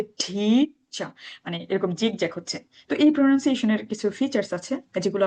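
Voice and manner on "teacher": drawn-out "টিচার", long aspirated tee then dropping cha; English pronunciation. Shown as a demonstration. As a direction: stretch the first syllable of "টিচার" slowly, let the second fall. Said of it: "এটা উচ্চারণ হচ্ছে".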